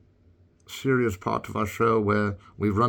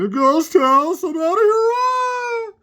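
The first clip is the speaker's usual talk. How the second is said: squeaky, much higher panicky cartoon voice, slow then crying out; scared Mario voice; 7 dB louder, slower than usual. Mario panicking at the ghost house in a high squeaky voice. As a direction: voice the hero fleeing the haunted house in falsetto. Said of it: "now that he's going".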